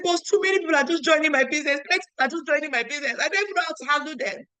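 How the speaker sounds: high, fast, breathless complaint; mock-weepy outpouring; retold tearful rush. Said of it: "I said".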